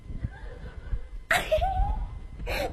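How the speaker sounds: high unvoiced giggle; coy swooning titter; breathy fangirl giggle.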